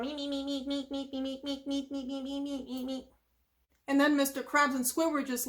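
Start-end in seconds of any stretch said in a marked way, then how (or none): 0.0-3.0 small, soft, rapid squeaky chirps; meeping anchovies. Retold or imitated. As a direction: imitate a crowd of meeping little fish. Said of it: "anchovies are just meeping there".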